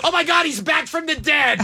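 mock shocked shout. Co-host imagines mom hearing the impression, mock shock. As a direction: pretend to shriek in astonishment.

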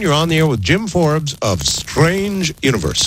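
normal talking voice

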